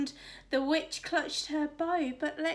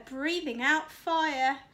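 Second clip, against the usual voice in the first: ordinary delivery, nothing marked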